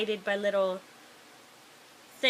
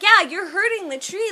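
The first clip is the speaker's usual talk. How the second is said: high, loud acted scolding; hammed-up indignant reprimand; 8 semitones higher, 8 dB louder than usual. [name] tells him off for hurting the tree, high scolding.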